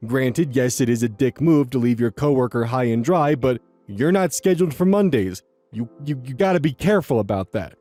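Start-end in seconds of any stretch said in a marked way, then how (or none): none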